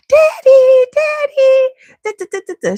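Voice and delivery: put-on high loud little-kid call; toddler calling daddy. Two-year-old Brooklyn calling for daddy, high and loud. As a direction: call for daddy from the top of the stairs.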